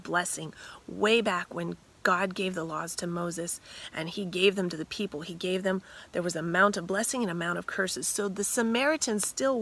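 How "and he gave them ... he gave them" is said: ordinary talk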